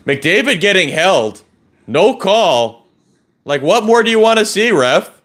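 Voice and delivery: frustrated outburst. Real, not acted. Frustrated at no penalty call.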